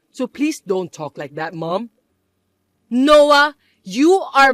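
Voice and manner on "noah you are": loud, higher plea of his name; loud appeal. Acted. Mother pleading loudly with her son, upset. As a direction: cry out to make him listen.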